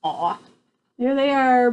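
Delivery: ordinary delivery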